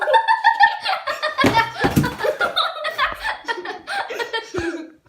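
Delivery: high loud laughing fit; loud burst of laughter. Real, not acted. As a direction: crack up loudly. Failed countdown, burst out laughing.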